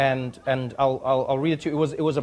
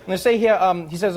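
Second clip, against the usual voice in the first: ordinary delivery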